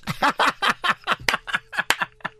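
breathless high-pitched laugh; high wheezing laugh. Real, not acted. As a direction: laugh hard, almost silent. Big laugh after the story.

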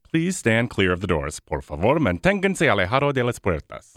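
imitating the monorail announcer; announcer reading a safety notice; train station announcement. Shown as a demonstration. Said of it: "can you do the announcement for the monorail".

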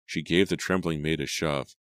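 usual speaking voice

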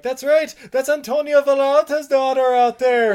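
high, loud gossiping shout; excited spectator. Retold or imitated. A man in the crowd recognizes her, loud high excitement. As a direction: announce it thrilled.

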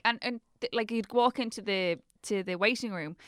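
ordinary talk, nothing marked

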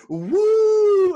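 huge high shrieking woo, very loud; Ric Flair woo. Retold or imitated. A wrestler greets cheering fans with a loud high woo. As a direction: let out a full-throated high woo. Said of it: "turns around to the crowd and just".

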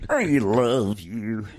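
slow, lightly voiced purple dinosaur; Barney the dinosaur. Camgirl dressed as Barney, slow mock dinosaur croon. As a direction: croon it slowly like a kids' TV dinosaur.

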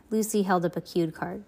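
ordinary delivery

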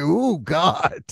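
shocked "ooh, God"; shocked gasp. Retold or imitated. Retold shocked reaction.

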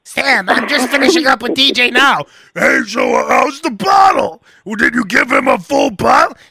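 wife and husband squabbling over baby, mock-shrill; mock bickering couple. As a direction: bicker like a frazzled parent. Acted couple quarrel.

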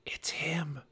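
hushed, voiceless whisper; soft, breath-only murmur. A narrator's reading. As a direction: whisper it under your breath. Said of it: "Annie whispered".

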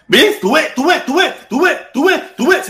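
high, loud gleeful repetition; triumphant see. Real, not acted. Host finds the lost sponsor banners, crowing high and loud. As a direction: crow over finding it at last.

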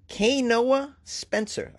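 "K Noah Spencer" said slowly, each part spaced, pitched high; careful name reading. Shown as a demonstration. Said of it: "I hope I said that correctly".

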